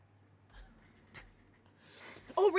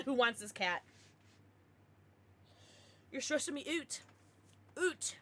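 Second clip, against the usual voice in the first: usual speaking voice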